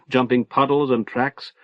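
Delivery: ordinary delivery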